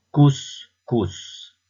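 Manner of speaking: "Couscous" as two separate, matching beats; Couscous dictated high, one syllable at a time. Shown as a demonstration. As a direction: say "Couscous" with a pause between cous and cous. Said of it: "repère le son qui se répète".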